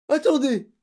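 high, loud plea; panicked shout; desperate cry. A narrator's reading. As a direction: cry out like a cornered pupil. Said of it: "cria-t-il".

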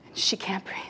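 a hushed, airless whisper; breathless whisper. A narrator's reading. Storyteller whispering to the audience about the desperate mother.

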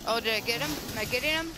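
excited. Real, not acted. Player hits the pinned enemy.

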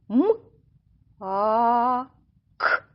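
М, а, к as three separate sounds; sounding out. Shown as a demonstration. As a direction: count out М... а... к, holding the а. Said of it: "Тепер рахуємо".

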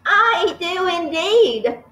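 high, slow rehearsed cook voice; cook reciting. Acted. Cook reciting her rehearsed line to the headmistress. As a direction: say it like learnt lines. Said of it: "the cook said".

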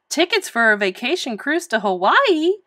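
incredulous, voice climbing at the end; amazed gasp. A narrator's reading. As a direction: ask in stunned delight. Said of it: "they gasped".

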